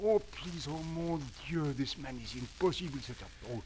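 put-on exasperated French accent; flustered French theater director.

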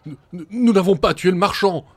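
very high, loud stammering outburst; stammering exclamation. A narrator's reading. Eldest farmhand to the crowd, high stammering outburst. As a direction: blurt it out in a panic. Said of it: "s'exclama le plus âgé".

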